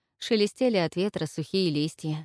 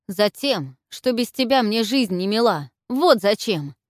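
louder, hot outburst; flaring up; 4 semitones higher, 7 dB louder than usual. A narrator's reading. Zhelan flares up at Olga, loud angry outburst. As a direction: burst out in anger. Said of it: "вспылил он".

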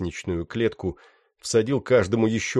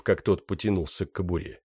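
plain reading voice

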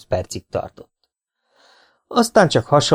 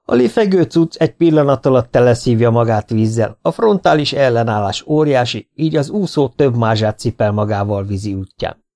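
ordinary delivery

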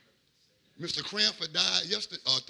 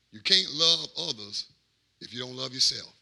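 normal talking voice

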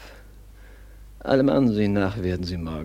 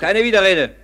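loud, high, furious cut-off; cutting command; 12 semitones higher, 12 dB louder than usual. Acted. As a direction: slam the objection shut. Herr schneidet dem Diener ab. (wütend und laut)

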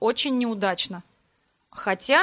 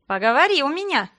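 mock threat with a raised fist; threatening; 4 semitones higher, 5 dB louder than usual. A narrator's reading. Sister shaking her fist at teasing brother, mock threat. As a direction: warn him off, half-playful.

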